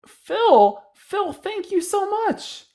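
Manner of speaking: high squealing gratitude, slow; overjoyed thanks. Real, not acted. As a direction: gush thanks to Phil in delight.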